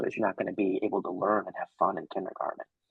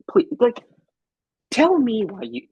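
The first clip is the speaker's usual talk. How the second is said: pleading; 14 semitones higher, 8 dB louder than usual. Retold or imitated. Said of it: "I said".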